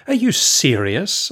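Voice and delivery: high, drawn-out challenge; incredulous. A narrator's reading. Prophet interrupting the refugees, incredulous.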